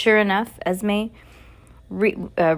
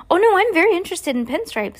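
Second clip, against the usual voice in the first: higher, louder forced eagerness; fake enthusiasm; 10 semitones higher, 4 dB louder, faster than usual. Klaus faking delight over his gift, higher and forced. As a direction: sell the lie brightly. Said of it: "who could lie as well as his sister".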